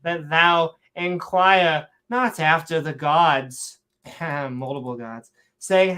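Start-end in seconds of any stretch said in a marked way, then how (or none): 0.0-3.8 slow, declaimed fake-Shakespearean warning; mock Shakespearean accent. Forbidding questions about other gods, mock Shakespearean accent. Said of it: "saying it in a Shakespearean accent".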